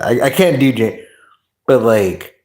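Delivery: ordinary delivery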